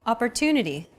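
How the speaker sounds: "Opportunity" with "tu" stressed and held longer; stress on third syllable. Shown as a demonstration. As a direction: lean on the "tu" in "Opportunity". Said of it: "The stressed syllable to in opportunity".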